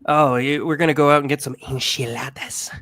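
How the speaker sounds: "enchiladas" in careful, exaggerated Spanish pronunciation; over-enunciated Spanish word. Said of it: "to over enunciate".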